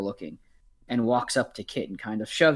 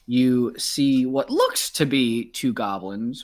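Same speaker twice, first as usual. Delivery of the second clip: normal talking voice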